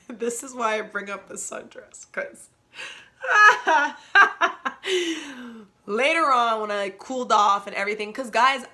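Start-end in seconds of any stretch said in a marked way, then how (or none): 3.2-5.6 burst of laughter. Real, not acted. Embarrassed laugh mid-story.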